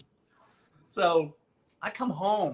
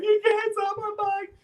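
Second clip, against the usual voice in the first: much higher, crying plea; mock bawling plea; 4 dB louder than usual. Boy begs his sister through mock tears, high crying. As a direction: whine like a kid sobbing over his bike. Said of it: "bawling my head off to my sister".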